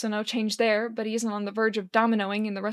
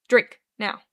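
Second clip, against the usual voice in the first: firm order; 7 dB louder than usual. Acted. Charlie orders drunk Oscar.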